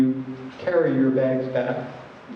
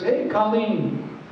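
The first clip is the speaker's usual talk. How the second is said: slow, higher sing-out hello; calling back greeting; 5 semitones higher, much slower than usual. Retold or imitated. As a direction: imitate a friendly hail in return. Man greets her back in story.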